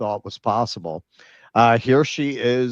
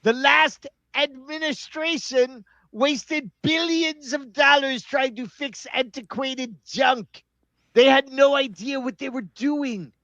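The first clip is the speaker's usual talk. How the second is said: mock Trump voice; 14 semitones higher than usual. Podcaster reading out a Trump post, mocking bluster. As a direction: puff it up like a blustering president.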